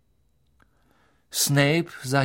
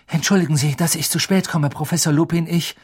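breathless apology; 5 semitones higher, 4 dB louder than usual. A narrator's reading. Harry apologising, late.

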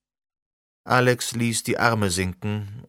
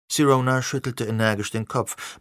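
ordinary delivery, nothing marked

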